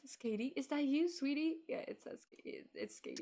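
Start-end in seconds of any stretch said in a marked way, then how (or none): 1.7-3.2 breathy aside under the breath; whispering. Real, not acted. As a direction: whisper it to yourself. Reading name aside.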